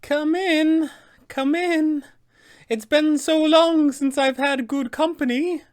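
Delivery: put-on high, sing-out old witch welcome; Baba Lysaga. Hag calling out to the party to come in, high welcoming witch voice. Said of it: "will emerge, calling out to the party".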